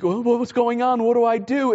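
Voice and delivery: lightly acted frightened child; mock scared kid. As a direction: voice a worried kid who can't find mom. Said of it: "the kid is nervous".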